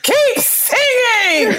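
high, loud, slow drawn-out shout; cheering shout. Retold or imitated. Friend at the top of the stairs cheering her on, loud. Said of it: "Serena would be ... stairs like".

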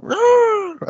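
loud high Zord roar; dinosaur voice. Retold or imitated. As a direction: roar like a toy dinosaur robot. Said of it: "Remember his Zord? We're going".

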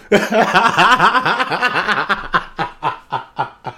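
long rolling laughing fit; real belly laugh. Real, not acted. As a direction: burst out laughing at your own song. Cracks up at own song.

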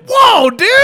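high, loud startled whoa; shocked yelp. Retold or imitated. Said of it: "I was like".

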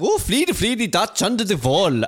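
high sing-song Swedish-chef babble; hammed-up Scandinavian gibberish, pitched high; mock Swedish accent. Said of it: "It's very Swedish of you".

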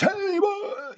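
very high, loud mock yell of a hype speaker; imitating stage screaming. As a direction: scream it like a showman working the crowd. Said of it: "they're screaming on stage".

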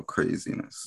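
breathy whisper to himself. Real, not acted. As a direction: whisper it under your breath. Repeats word, trying variations.

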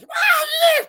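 very high, loud whoop; cowboy cheer. Retold or imitated.

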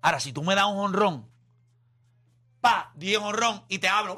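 usual speaking voice

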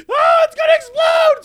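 hammed-up mock panic; fake panic. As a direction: pretend panic to scare people off. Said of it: "be like".

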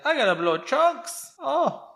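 high, slow cartoon voice; Miriam. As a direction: stretch it out in a high silly cartoon voice. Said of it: "Miriam ... she's like".

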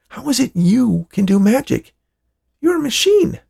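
can't believe a machine did magic; astonished. Acted. Hal to his robot after being rescued, astonished disbelief.

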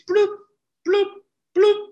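high, held scanner bloops; scanner beep imitation. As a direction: mimic a checkout scanner, spaced out. Imitating bored cashier's scanner.

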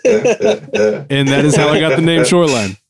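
laughing. Real, not acted. Punchline of his story.

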